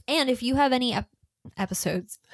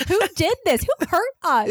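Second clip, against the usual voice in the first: mock dramatic; 9 semitones higher, 3 dB louder than usual. Mock drama about violence.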